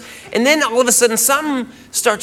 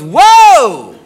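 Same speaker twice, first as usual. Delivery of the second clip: loud, drawn-out "whoa" swooping high; retold girlfriend's alarmed shout; startled whoa; 4 semitones higher, 8 dB louder than usual. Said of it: "And she goes".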